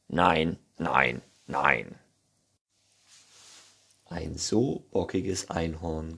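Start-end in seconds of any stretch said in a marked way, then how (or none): 0.1-1.9 low, stubborn refusal; sulky low unicorn voice; grumpy Neinhorn. A narrator's reading. (trotzig tiefe Stimme)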